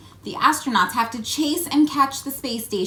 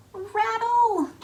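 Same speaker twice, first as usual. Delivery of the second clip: high rattle sound effect; 12 semitones higher than usual. Pretend. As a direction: pitch "Rattle!" way up, playful.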